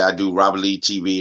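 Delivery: ordinary talk, nothing marked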